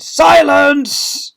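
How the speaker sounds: long, high, deafening shout. A narrator's reading. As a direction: shout to silence a whole hall. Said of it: "Miss Seeth shouted".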